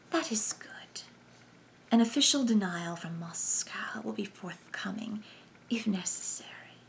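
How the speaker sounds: hushed sibilant whisper. A narrator's reading. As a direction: whisper with hissing s sounds. Number one's whispered lines.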